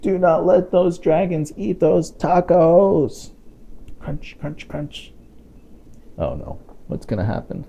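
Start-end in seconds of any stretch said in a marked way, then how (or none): 0.0-3.3 slow, high, every word pressed down; slow urgent plea. Acted. Parent warning the listener not to let dragons eat, slow and pleading. 4.0-5.1 hushed crunching. Acted. Sound effect of eating.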